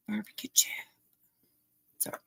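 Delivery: whispered barbecue chip; whispering. Real, not acted. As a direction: whisper it to yourself. Whispered repeat.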